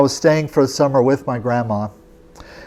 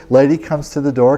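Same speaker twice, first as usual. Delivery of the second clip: normal talking voice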